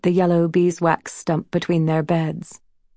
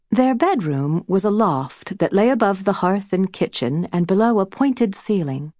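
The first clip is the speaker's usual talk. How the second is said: ordinary delivery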